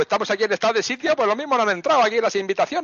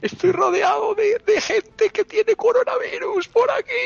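frightened whimper; 10 semitones higher than usual. Pretend. Hiding, surrounded by infected people.

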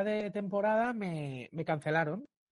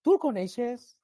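higher, eager lady voice; lady asking daughter; 7 semitones higher, 4 dB louder, slower than usual. Retold or imitated. Lady in the metro asking her daughter, high eager voice. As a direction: ask it like an excited mother. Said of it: "Y la filla ve".

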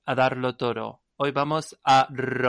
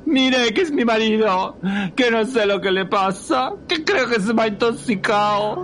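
panicked wife; 8 semitones higher, 5 dB louder, faster than usual. Acted.

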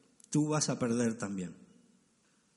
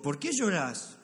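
dismissive husband; 7 semitones higher, 4 dB louder, slower than usual. Retold or imitated. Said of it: "Le digo".

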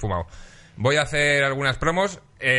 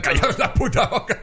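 laughing; 8 semitones higher than usual. Real, not acted. Jokingly shushing friends mid-laugh.

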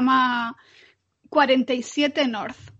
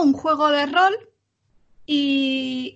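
normal talking voice

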